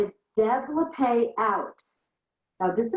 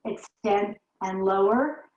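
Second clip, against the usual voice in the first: slow; slower than usual. Shown as a demonstration. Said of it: "Two more slow".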